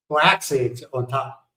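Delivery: ordinary talk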